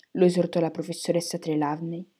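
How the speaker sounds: ordinary delivery, nothing marked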